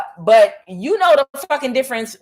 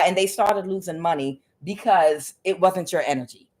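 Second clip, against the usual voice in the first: ordinary delivery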